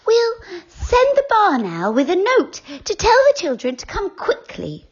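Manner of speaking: high, light fairy voice; decide it at last, gently; fairy Silky. A narrator's reading.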